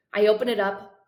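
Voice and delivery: ordinary delivery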